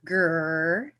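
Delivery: a light playful mock tiger grr; Tony the Tiger growl. Shown as a demonstration. Chair demoing the second syllable of her name, a playful tiger growl. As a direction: growl it like a cereal-box tiger, just for fun. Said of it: "Okay, Tony the Tiger".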